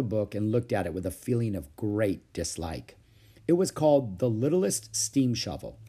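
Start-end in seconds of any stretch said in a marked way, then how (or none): none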